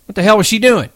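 loud, high-pitched furious question; angry demand. A narrator's reading. Curly questioning George about the girl, angrily.

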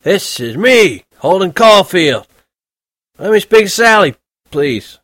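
put-on high, loud, slow drunken bellow; drunken shouting. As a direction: slur it loud into the phone, very drunk. Drunk Holden on the phone.

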